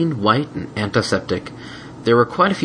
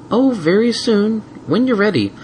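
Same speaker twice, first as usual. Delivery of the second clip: sweet mare voice; 13 semitones higher than usual. Acted. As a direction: lure him in sweetly. Twilight voiced.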